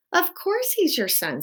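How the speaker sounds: high-pitched wifely retort; exasperated mouse wife. A narrator's reading. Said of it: "said Antoinette".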